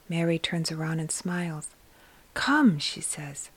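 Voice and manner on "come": "Come" lifted high and a little louder, welcoming; warm invitation. Acted. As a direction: call them in gently with a rising lift. Mary welcomes shepherds warmly.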